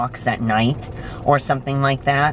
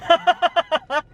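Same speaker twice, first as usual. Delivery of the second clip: laugh. Real, not acted. Laughs at the story.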